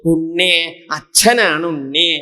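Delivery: slow, pleading call from a father; acted, drawn-out summons. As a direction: call to a lost child, stretching the endings. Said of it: "എന്ന് വിളിച്ചു".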